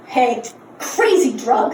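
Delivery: ordinary talk, nothing marked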